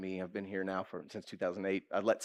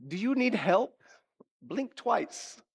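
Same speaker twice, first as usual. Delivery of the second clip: high, baffled mock worry; mock concern; 6 semitones higher than usual. High school friends worried about him, high-pitched mock concern. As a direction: check on him as if he were a hostage. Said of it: "they just looked at me like".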